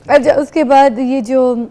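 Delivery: normal talking voice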